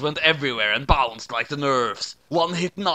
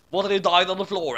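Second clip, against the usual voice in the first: usual speaking voice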